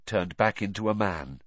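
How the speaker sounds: usual speaking voice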